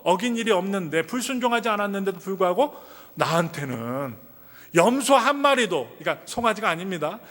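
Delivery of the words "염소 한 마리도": 염소 한 마리도 slow, high and louder; 염소 한 마리도, drawn out and raised; stress on goat. Acted. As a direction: lean hard on 염소 한 마리도. Stresses the goat, not calf.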